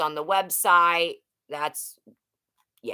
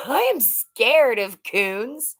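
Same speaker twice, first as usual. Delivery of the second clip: drawn-out, high-pitched mock dread with a laugh; playful fear; 6 semitones higher, 3 dB louder, slower than usual. Streamer admitting fear of raccoons to viewers, playfully scared. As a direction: ham up being scared, slowly. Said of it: "I'm scared".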